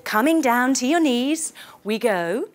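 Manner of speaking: ordinary delivery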